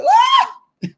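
high shouted woo; praise whoop. Real, not acted. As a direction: let out a joyful whoop. Preacher whooping.

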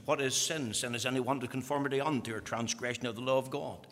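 ordinary talk

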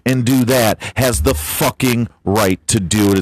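ordinary talk

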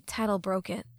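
ordinary delivery

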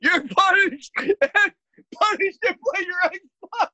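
high, cracking up while quoting; laughing mockery. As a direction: repeat the silly punishment through helpless laughter. Listener laughingly mocks the parent's punishment.

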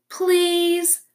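high, whiny fox plea; pleading fox. A narrator's reading. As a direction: beg like a sly fox. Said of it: "said Fink".

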